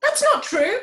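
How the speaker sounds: sharp, high, loud denial; indignant protest. Acted. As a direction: shoot the accusation down hard. Jason indignantly denies running off.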